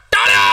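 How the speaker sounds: very loud, low mimicked bleat; lamb cry imitation. Contestant performs a lamb's cry for the crowd, loud mimicry. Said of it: "असा आवाज काढला महाराज कोकराचा".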